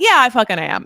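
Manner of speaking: loud, emphatic comeback; Christine's retort. Retold or imitated. Cast member owning the insult, loud retort. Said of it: "And Christine being like".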